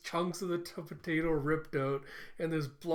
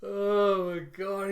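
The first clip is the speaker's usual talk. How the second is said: laughing; 4 semitones higher, 6 dB louder, much slower than usual. Real, not acted.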